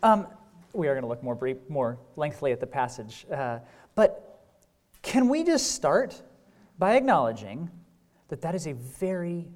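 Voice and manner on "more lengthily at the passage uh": ordinary talk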